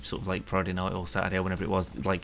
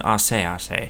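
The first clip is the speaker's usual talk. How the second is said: imitating Fred Elliott; slower than usual. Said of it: "he's always going".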